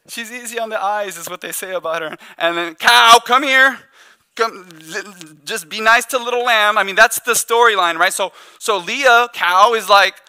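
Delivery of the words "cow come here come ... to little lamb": a slow, loud call across the house, higher up; hammed-up dad summoning his daughter; mock father calling.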